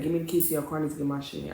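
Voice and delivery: low, soft, restrained demand; talking quiet. Retold or imitated. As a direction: keep it down while asking for the keys. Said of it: "I'm talking quiet".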